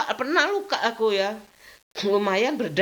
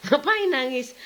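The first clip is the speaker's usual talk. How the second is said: mock scolding son; 7 semitones higher than usual. Son scolds crying mother, retold.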